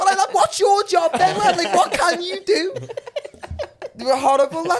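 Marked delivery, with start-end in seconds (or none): 0.1-2.7 slow, louder, higher taunt; mocking challenge. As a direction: drag it out to mock him. Mocking the officer's uselessness.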